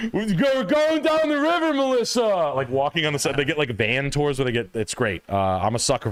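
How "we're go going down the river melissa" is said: hollering like a wasted rafter; drunk river rafter. Retold or imitated. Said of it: "being like".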